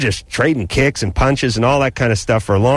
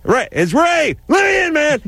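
put-on much higher drunken yell at the door; drunk friend screaming. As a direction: holler to be let in, retelling a drunk buddy. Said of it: "screaming at the top of his lungs".

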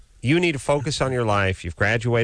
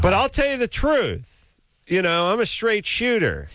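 blowhard voice; 11 semitones higher than usual. Retold or imitated. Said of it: "I like this blowhard".

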